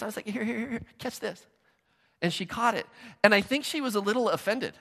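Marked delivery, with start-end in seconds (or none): 0.3-1.5 much softer, coaxing, easy; gentle toss. Retold or imitated. Pastor gently tossing the ball to pregnant Bronwyn, soft and coaxing. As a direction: coax her gently like an underhand lob. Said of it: "I came down here like this".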